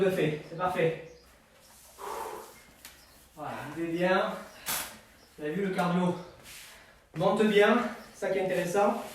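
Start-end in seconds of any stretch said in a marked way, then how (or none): none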